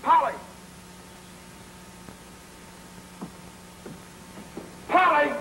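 high-pitched repeated call; yelling. Acted. Husband keeps calling his wife, yelling her name. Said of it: "Stop yelling".

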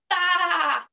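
loud, high bleat; loud baa. Acted. Duck bleating loudly in the story, silly loud baa. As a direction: bleat it out as loud as you can. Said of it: "as loud as he could".

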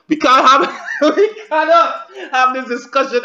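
laughing while pleading; laughing through it. Acted. Reaction host acting out a plea at the door, laughing. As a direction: break into laughter mid-plea.

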